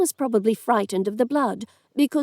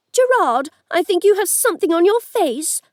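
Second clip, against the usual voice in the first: sarcastic challenge; 7 semitones higher, 4 dB louder than usual. A narrator's reading. Cindy confronting the lord.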